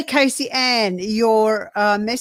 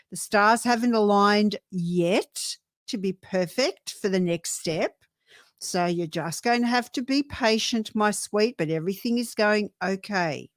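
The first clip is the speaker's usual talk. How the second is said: ordinary talk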